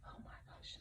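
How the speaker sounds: soft unvoiced aside; whispering while the cat climbs around. Real, not acted. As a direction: breathe it under your breath.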